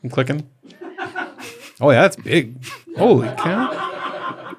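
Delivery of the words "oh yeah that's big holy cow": amazed. Real, not acted. Sees the photo of the mortar.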